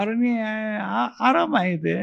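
soft, high, hesitant admission; sheepish confession. Acted. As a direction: confess haltingly as a caught Adam. Adam answers God while hiding.

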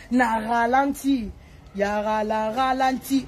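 "ralenti" drawn out very slowly; slowed down. Shown as a demonstration. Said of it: "O mela diamba na". (koloba "na ralenti" malembe mpenza)

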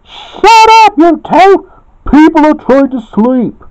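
very high, loud squawk of complaint; neighbours' yell. A narrator's reading. Neighbours kept awake yell at the two fighters, angry shout. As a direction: yell shrilly at the noisy pair. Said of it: "yelled the neighbors".

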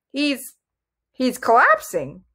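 mildly shocked, hesitant realization; stunned. A narrator's reading. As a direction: stammer it in disbelief. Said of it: "said in a stunned voice".

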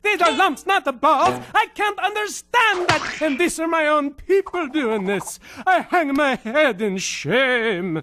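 put-on slow, loud comic rant in a Swedish accent. The ranting Swede fumes at viewers about meatballs, slow and loud in a Swedish accent. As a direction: blow up over meatballs like it's a personal insult. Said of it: "with a ranting Swede".

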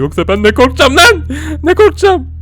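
mock fear. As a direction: voice climbing into a high squeal. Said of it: "korktuğumu sansınlar diye". (korkmuş gibi incelen ses)

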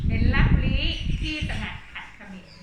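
measured, lilting Thai verse reading; poem recital. Acted. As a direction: read the verse aloud for the class. Teacher reads verse line.

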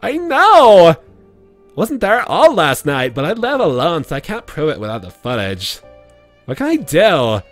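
put-on high, loud valley girl. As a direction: squeal it like a California girl. Reading Katie's messages aloud.

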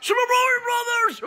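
high, excited fan cry; excited squeal. Acted. Fan spotting a long-missed show, thrilled squeal. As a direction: shout the title in high-pitched delight.

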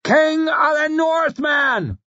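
high, slow shriek from the crowd; far-off crowd shriek, drawn out and high. Acted. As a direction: shriek it as a frenzied acclamation. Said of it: "someone shrieked way back in the crowd".

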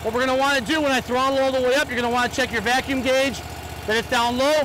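shouting over engine. Real, not acted. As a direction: project over the running engine. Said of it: "it may be hard for you to hear me".

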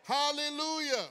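loud, ringing preacher's call; full-force preaching. Acted. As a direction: rouse the church to shout.

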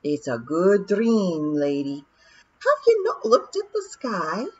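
slow grinning Scots pixie; pleased wee pixie in broad Scots; broad scottish accent. Acted. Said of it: "He grinned".

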